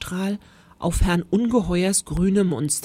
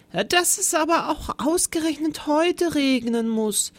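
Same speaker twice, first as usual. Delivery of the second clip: high, sighing little man's voice; a worried little sigh, pitched high; fretful high sigh over the rain; sighing small teacher; 8 semitones higher than usual. Acted. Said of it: "seufzte er".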